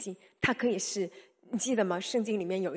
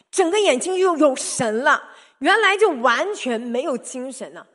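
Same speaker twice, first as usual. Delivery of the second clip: much louder, thrilled telling; excited; 4 semitones higher, 13 dB louder than usual. Real, not acted. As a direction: burst out with wonder at the change. Mother reliving child's sudden healing.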